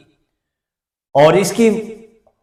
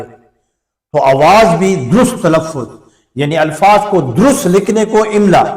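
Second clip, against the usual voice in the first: ordinary talk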